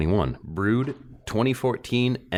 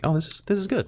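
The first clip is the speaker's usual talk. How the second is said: impressed; 12 semitones higher, faster than usual. Retold or imitated. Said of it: "and I'm like".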